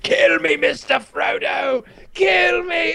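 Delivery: very high, drawn-out begging cry; hammed-up dying plea. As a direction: wail it like a melodramatic dying hobbit. Plays dying Sam begging Frodo.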